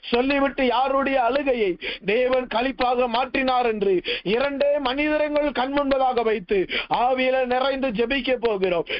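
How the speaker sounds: ordinary talk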